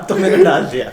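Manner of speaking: wife's punchline through laughter; laughing. Retold or imitated.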